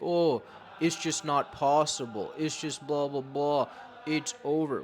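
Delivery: dismissive take-off of someone giving up; mock complaining. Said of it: "and complain".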